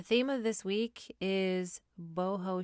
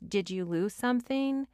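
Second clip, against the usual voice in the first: higher, louder mock-polite jab; sarcastic challenge; 5 semitones higher, 5 dB louder than usual. Confronting the shopper who stares in her way, sarcastic. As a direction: needle the staring shopper. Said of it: "I make eye contact with you and I say".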